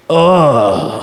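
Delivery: imitating a roommate's low, drawn-out groan; put-upon groan. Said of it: "casually looked at me and said".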